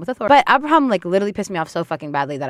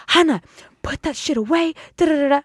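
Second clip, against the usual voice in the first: freaked-out scolding; 8 semitones higher than usual. Retold or imitated. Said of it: "Abraham freaked out and was like".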